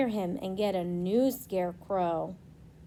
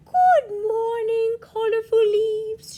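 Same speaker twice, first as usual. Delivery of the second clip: wind greeting; 12 semitones higher, 6 dB louder than usual. Acted. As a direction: greet the leaves grandly as the wind.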